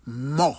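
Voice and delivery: "mo" with an open aw vowel. Shown as a demonstration. Teacher shows listeners the accented O, open vowel in "mo". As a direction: say "mo" as "maw". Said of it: "avec accent force là, c'est pour dire O".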